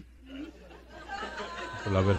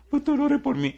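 much higher mock plea; pleading churchgoer; 13 semitones higher than usual. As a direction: whine it like a needy member. Mimics a churchgoer asking. (feligrés suplicando en tono agudo)

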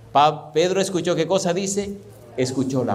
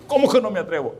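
acted high, indignant comeback; indignant challenge; 6 semitones higher, faster than usual. Offended person answering a dare in the story, indignant. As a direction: throw it back as a pitched-up challenge.

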